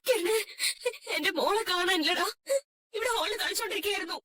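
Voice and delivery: sobbing plea for help; broken, weeping phone call; crying distress. Acted. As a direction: report a missing child through tears. Mother phones about missing child.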